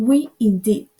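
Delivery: loud, outraged mouse exclaims; indignant cry. A narrator's reading. The trembling mouse crying out at Alice, outraged. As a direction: burst out in offended protest. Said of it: "cried the mouse".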